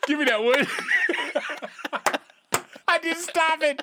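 laughing. Real, not acted. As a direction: crack up at the joke. Laughing after a joke.

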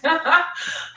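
a high laugh that cracks with emotion; high teary laugh. Real, not acted. Guest on a podcast laughing through tears about her breakup.

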